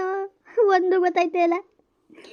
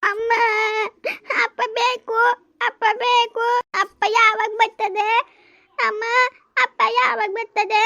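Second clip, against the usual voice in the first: put-on whiny child; 4 semitones higher, slower than usual. Child asks for father.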